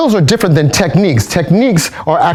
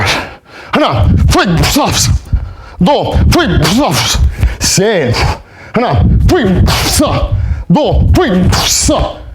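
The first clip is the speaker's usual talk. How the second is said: calling drill commands; 2 semitones higher, much slower than usual. Acted. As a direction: call the steps in a steady beat for the class. Calls the jump drill cadence.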